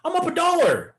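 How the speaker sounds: hammed-up impatient trader; mock eager trader. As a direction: squeak it out like a jumpy newbie. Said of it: "would you be like".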